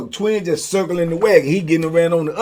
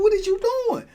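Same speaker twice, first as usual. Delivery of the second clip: much higher exasperated question; faster than usual. Retold or imitated. Storyteller scolding Vito about the money, high-pitched. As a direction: shoot the pitch up in frustration. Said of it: "I said".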